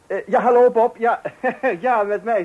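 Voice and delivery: loud, high, bubbling over; beaming phone hello; excited greeting. Acted. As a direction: burst out with happy news. Excited call to friend Bob.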